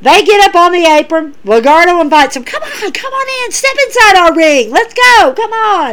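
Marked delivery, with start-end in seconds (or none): 2.4-5.9 taunting call. Retold or imitated. Wrestlers call rivals into ring.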